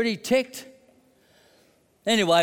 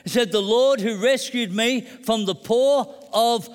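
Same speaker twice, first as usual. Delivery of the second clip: preaching at full force; 4 semitones higher than usual. Acted. Preacher proclaiming God's faithfulness to the congregation, full-force preaching. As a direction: preach it out with rising conviction.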